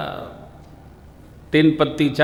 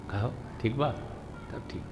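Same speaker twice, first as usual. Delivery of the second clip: soft, close-up murmur between friends; quiet friendly chat; 4 semitones lower, 17 dB quieter, much faster than usual. Retold or imitated. Friends sitting close greet each other softly. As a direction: speak softly as if sitting side by side. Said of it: "सखा सखा पास बैठते हैं, क्या बोलते हैं".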